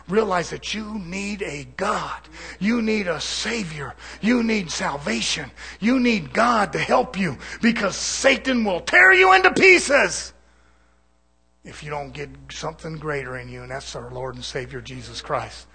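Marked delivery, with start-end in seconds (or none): 0.1-10.3 slow, hammered sermon rising to a full shout; preaching, building to a shout. Real, not acted. Preacher warning brother that he needs God, slow preaching building to a shout. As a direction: drive each need home, louder each time.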